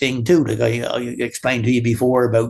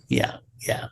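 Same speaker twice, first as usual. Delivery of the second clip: whispered yeah; 7 dB quieter than usual. Real, not acted. Whispered agreement aside.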